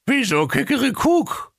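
very high puzzled voice; puzzled octopus. A narrator's reading. Octopus questioning the parrot in a high puzzled voice. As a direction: ask it high and baffled.

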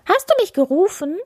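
high, quick hare squeak; delighted hare. A narrator's reading. Hare asks the bear, high and cheerful. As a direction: ask eagerly in a tiny high voice. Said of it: "freute sich der Hase".

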